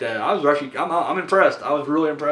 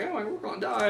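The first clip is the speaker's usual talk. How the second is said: a soft, mock-whiny wail; whiny victim; 6 semitones higher, 9 dB quieter than usual. Imitating Sharon Stone's whiny character, soft mock whine. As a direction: whimper it like a helpless victim. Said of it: "she was just like the whiny".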